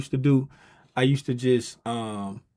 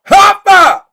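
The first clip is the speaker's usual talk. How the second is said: excited shout; 19 dB louder than usual. Real, not acted. Hyped call to stream audience.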